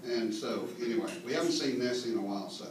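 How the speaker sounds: ordinary talk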